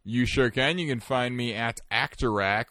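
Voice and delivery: usual speaking voice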